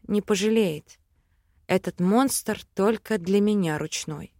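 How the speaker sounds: plain reading voice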